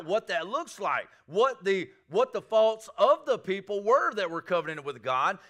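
fervent Southern preacher calling out; preaching at full force. Acted. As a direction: drive it home like a revival preacher.

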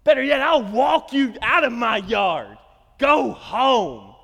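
put-on loud, high, slow kid's shout of fury; retold angry yell. Boy ordering best friend Corey out of his yard, angry shout. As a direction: yell him off your property like a furious boy. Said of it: "I get really mad at him".

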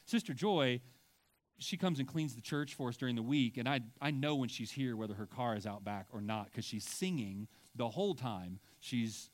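usual speaking voice

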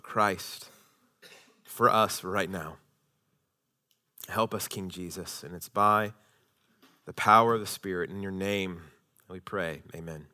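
soft, hushed prayer voice. Acted. Pastor leads the church in prayer, soft and hushed.

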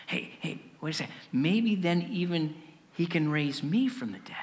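soft, low, halting thinking aloud; hushed dawning realisation. Acted. Disciples lying awake thinking to themselves, hushed dawning realisation. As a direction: think it to yourself, barely above a murmur. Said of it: "thinking to themselves".

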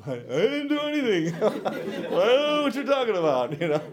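high laughing protest. Retold or imitated. Teen caught off guard, laughing.

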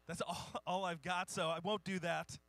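normal talking voice

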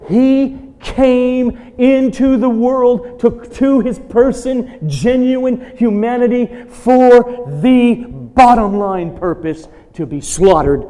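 loud, high, slow preaching build; forceful preaching. Acted. Pastor driving home God's sovereignty to the congregation, loud preaching. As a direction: proclaim God's control with rising force.

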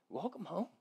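soft, hushed welcome home; a father gently taking his son back; tender welcome. Acted. Father welcoming his son home, soft and tender. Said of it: "And the father just goes".

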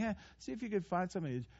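"Eh" said casually, quiet and fast; offhand. Shown as a demonstration. The wrong reading of Jesus' command, careless and quick. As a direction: toss it off like a shrug. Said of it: "it's not something".

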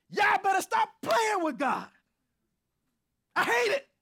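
high, loud shouted warning; fiery preacher shout; preaching at full force. Real, not acted. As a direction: shout it straight at the congregation. Preacher's own shouted aside.

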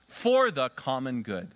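said slowly, every word spaced; slow, drawn-out answer. Shown as a demonstration. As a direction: pastor leading the church in the answer, slow and deliberate. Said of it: "Spirit, do what?".

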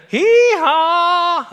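high, loud, slow braying hee-haw; hammed-up donkey bray for the congregation. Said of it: "he makes a donkey noise".

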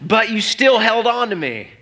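high, slow, ringing declaration; stretched-out, exclaimed confession; impassioned prayerful preaching. Acted. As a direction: proclaim it to God with rising fervour. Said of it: "And I'm always asking God".

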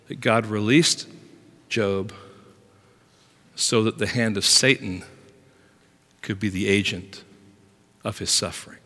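usual speaking voice

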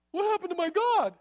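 high-pitched alarmed cry; high, dismayed question; distressed Micah. Retold or imitated. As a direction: act out a man finding he's been robbed. Micah finds his gods gone.

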